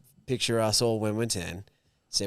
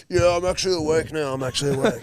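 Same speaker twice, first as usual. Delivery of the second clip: sheepish mate; 5 semitones higher, 4 dB louder than usual. Retold or imitated. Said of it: "Burmo's like".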